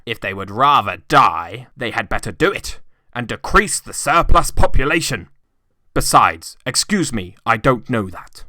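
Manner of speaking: put-on harsh old miser. A narrator's reading. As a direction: refuse the gentlemen flatly. Scrooge's cruel reply.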